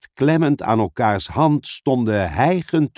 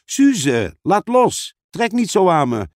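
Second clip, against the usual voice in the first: higher, urgent demand to let go; annoyed protest; 7 semitones higher than usual. Acted. Pieter telling Suze to stop pulling him, annoyed. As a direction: protest at being pulled.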